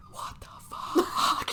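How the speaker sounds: slow, drawn-out mock whisper; stunned whisper. Retelling a friend's shock at the fight, stunned whisper. Character's stunned reaction, retold.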